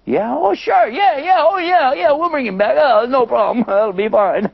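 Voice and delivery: high, eager yes-yes babble; eager brothers. Acted. Brothers promising Yusuf to bring Benjamin back, high and eager. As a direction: gush agreement nervously.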